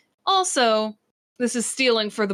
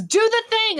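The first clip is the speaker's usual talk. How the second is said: loud, high-pitched cry of command; acted scream; 13 semitones higher, 5 dB louder than usual. Game master voices the character giving an order, high acted scream. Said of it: "So he screams".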